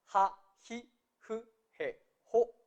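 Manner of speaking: JA, JI, JU, JE, JO each opened with an audible h; H pronounced. Shown as a demonstration. Teacher drilling the ha column, h pronounced. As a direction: recite JA, JI, JU, JE, JO one by one, sounding each h. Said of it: "Recuerden pronunciar la H".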